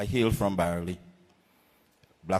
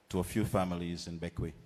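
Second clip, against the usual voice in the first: ordinary talk, nothing marked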